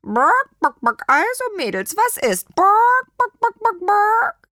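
high, loud clucking hen; rally the girls as a hen. A narrator's reading. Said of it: "fragte Nofretete".

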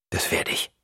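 brief, low-key answer; curt. A narrator's reading. Husband promises his wife briefly, curt. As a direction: say it shortly, nothing added. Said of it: "sagt er kurz".

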